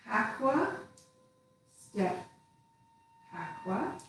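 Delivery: soft, breathy step calls, said while moving; counting the steps. Shown as a demonstration. Teacher counting students through tai chi steps, soft cue calls. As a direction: cue each step quietly as you move.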